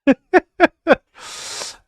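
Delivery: big high-pitched burst of laughter; loud high laugh. Real, not acted.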